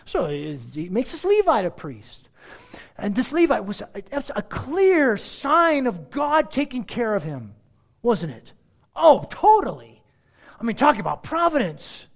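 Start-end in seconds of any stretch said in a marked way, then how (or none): 3.0-12.1 sarcasm laid on really thick; mock amazement, swelling on the key words; heavy sarcasm. Real, not acted. Preacher mocking the idea of God's providence, heavy sarcasm. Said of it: "I'm being sarcastic here. I'm laying it on really thick".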